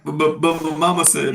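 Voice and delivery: laughing mock protest. Joining the mama joke.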